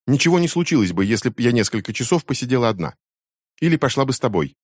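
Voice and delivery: a daughter snapping at her mother; indignant teen. A narrator's reading. Becky argues back. (возмущённая дочь, высоко и громко)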